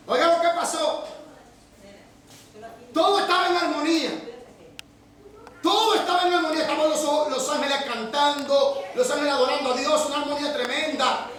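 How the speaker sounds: fervent sermon delivery, building intensity; preaching. Acted. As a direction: preach it with growing fervor. Building up the heaven scene.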